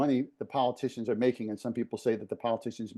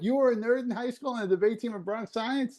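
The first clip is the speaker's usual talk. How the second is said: teasing; 9 semitones higher than usual. Retold or imitated. Friends teasing him in retelling.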